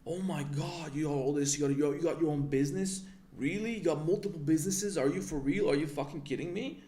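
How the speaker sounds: hammed-up disbelief of gushing friends; imitating impressed admirers. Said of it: "things that people praise me".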